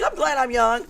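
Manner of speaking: high, loud, laughing quip; imitating amused friend. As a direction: blurt it brightly through a laugh. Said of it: "Olivia's like".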